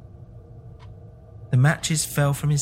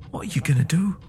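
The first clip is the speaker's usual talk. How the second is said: low scared voice; 5 semitones higher than usual. A narrator's reading. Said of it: "in a low, scared voice".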